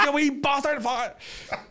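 high-pitched imitation of dad cursing; outraged dad, hammed up; mock outrage. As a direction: explode in comic indignation. Retold dad cursing after the prank.

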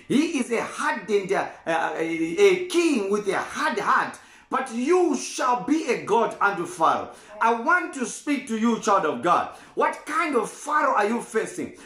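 ordinary talk

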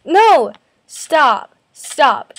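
loud, sudden No, then falling Stops; real shout. Real, not acted. Yelling at the stepsister who keeps interfering, loud. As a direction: shout to make her quit.

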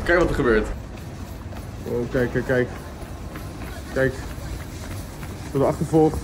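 ordinary talk, nothing marked

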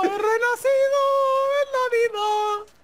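high, slow theatrical cry; dramatic mock proclamation. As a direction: proclaim it like a rebirth. Dramatic rebirth joke.

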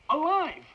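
ALIVE punched high and loud; high, loud stress on "alive"; emphatic revelation. Acted. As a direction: hit "alive" with a sharp leap in pitch and volume. Insists friend was wanted living.

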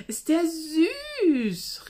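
high, slow, squealed süß; girl squealing with delight at the fox. Acted. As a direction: gush over a cute animal. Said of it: "rief Miriam entzückt".

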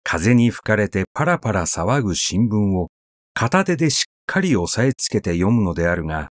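ordinary delivery

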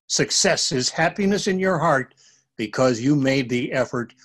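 ordinary delivery

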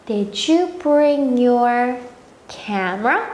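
very slow, clear teacher demo; slow model sentence. Shown as a demonstration. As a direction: stretch each word out for the kids. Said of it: "One more". (아주 천천히 또박또박)

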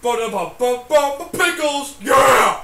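loud fanfare rising into a shout; hammed-up fanfare. Co-host caps the pickle joke, rowdy mock fanfare. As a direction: trumpet it out, then yell.

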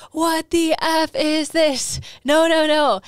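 high, loud panicked no; mock panic, rising to a yell; body panicking. Body panicking in retold scene.

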